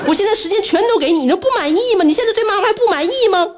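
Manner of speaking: high, exasperated mock reproach; retold scolding. Mother re-enacting her reproach to her son, high-pitched exasperation. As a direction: demand an answer, high and aggrieved.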